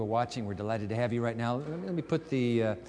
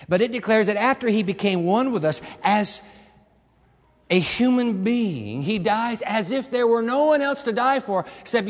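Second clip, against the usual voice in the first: louder, emphatic sermon voice; fervent preaching; 6 semitones higher, 6 dB louder than usual. Acted. Preacher pressing the prayer's meaning, loud fervent preaching. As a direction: press the point on the congregation.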